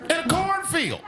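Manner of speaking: high-pitched disbelief with a sharp drop at the end; can't believe what you've been accused of; incredulous. Real, not acted. Incredulous at farmers' noise complaint.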